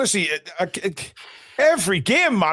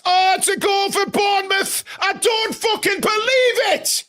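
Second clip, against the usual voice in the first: much higher, aghast at the equaliser; shocked disbelief; 10 semitones higher than usual. Real, not acted. Fan reacting to Bournemouth's equaliser, shocked disbelief. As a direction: cry out in horror at the late goal.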